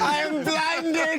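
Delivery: high, slow, hammed-up cry of pain; mock blinded actor. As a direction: overplay a dazzled wizard. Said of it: "I think he's taking a bit serious".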